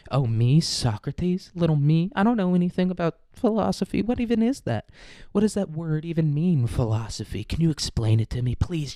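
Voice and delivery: high-pitched, hammed-up cluelessness; feigned innocence. Socrates pretending ignorance to bait a debate, mock innocent. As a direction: play dumb in a put-on little voice, pitch climbing.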